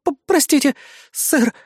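high, stammering apology; frightened squeak. A narrator's reading. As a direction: squeak it out timidly. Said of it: "испуганно пискнула она".

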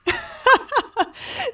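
high burst of amused laughter; bright giggle before speaking; laughing. Acted. Velaya laughs at the worry of being seen, laughing. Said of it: "Velaya lachte".

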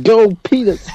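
high, shouted cheer; mock cheer. Mock cheering friend peeing.

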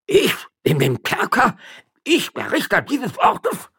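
very high, slow incredulous cry; outraged disbelief. Acted. Magistrate outraged at being threatened with jail. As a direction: splutter in disbelief.

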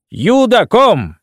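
slow, high barked German command; German command shout. Retold or imitated. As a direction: shout it like a soldier in a war film. Narrator shouts German phrase joyfully.

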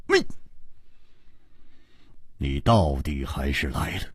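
grudging spite through the teeth; bitterly. A narrator's reading. Swordswoman resents the hero's untimely arrival, bitter. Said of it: "狠狠地说".

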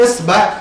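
إصبع said as esbaʕ, with an open e and an a before the ʕ; esbaʕ, clearly voweled; first vowel reading. Shown as a demonstration. Teacher giving the first of three forms. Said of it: "بثلاث إطلاقات ويكون معناها واحد".

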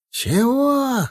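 very high, drawn exclamation; incredulous shout. A narrator's reading. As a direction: cry it out high without added volume. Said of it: "закричал Николаенко". (изумлённый высокий выкрик)

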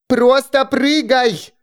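high, slow shouted command; shouting. Acted. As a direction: yell it out slowly. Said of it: "крикнуло Солнышко".

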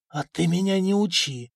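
rasping hoarse voice; raspy whisper. Acted. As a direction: rasp it out, half-voiced. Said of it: "просипел Сигеня".

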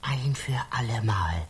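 low threatening whisper; menacing whisper. Acted. Interrogator hisses menacingly. (drohendes Flüstern)

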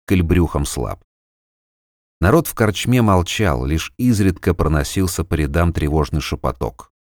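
plain reading voice

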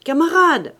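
loud, high hail to a comrade; calling out. A narrator's reading. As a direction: call after someone walking ahead. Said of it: "cria Fauchelevent". (appel crié, fort et aigu)

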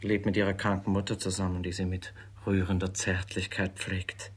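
normal talking voice

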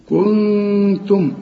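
كنتم stretched slowly with the nun hummed; slow nasal kuntum. Shown as a demonstration. Teacher recites for students, nasal nun. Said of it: "بتبقى عايزة غنة".

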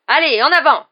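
very loud, high roar. A narrator's reading. Hagrid driving monsters into the paddock, roaring. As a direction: roar the beasts onward. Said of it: "rugit Hagrid en agitant les chaînes".

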